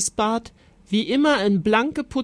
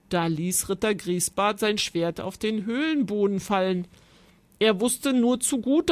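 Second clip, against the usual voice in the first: ordinary delivery, nothing marked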